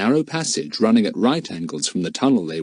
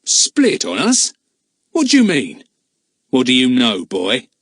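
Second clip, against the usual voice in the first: a man's rough challenge, raised; 3 semitones higher, 5 dB louder than usual. A narrator's reading. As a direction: bark the questions at the boy. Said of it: "asked Jake roughly".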